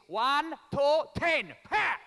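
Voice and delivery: loud, quick count ending in a high smack noise; Italian mum counting. Retold or imitated. Italian mum counts then smacks loudly. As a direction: snap the count short then shout the smack. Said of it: "like this".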